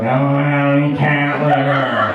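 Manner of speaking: lightly voiced snide mother; mock snotty mom. Mom denies having cat litter, mock snotty. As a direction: imitate a mom being snotty. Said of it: "being all cunty".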